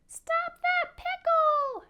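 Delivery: tiny peeping shout, extremely high; little almond voices. A narrator's reading. Seventeen almonds skitter after the pickle, high peeping cry. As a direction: peep it loud and slow as little almonds. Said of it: "peeped with their little almond voices".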